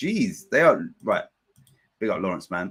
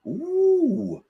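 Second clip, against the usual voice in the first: high, drawn-out ooh; a long, high-pitched ooh at the action; excited ooh. Real, not acted. Watchalong host reacting to a Fulham attack, excited high ooh.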